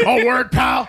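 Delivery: high shouted impression; hammed-up high shout; mock shout. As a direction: yell it up high like a cartoon boss. Shouting mock Vince line.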